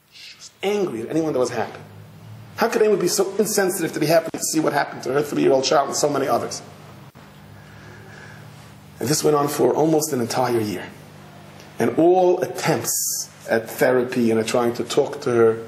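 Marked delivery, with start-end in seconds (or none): none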